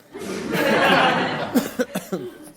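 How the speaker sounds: long falling high laugh. Real, not acted. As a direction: laugh freely, amused. Laughs after teasing Jim.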